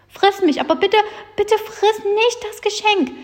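high, desperate begging; pleading cry. Acted. Little grump begs the giant to spare the present, crying out. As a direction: beg him, bitte bitte, for the present. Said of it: "rief Knötterchen".

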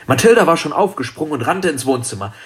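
plain reading voice

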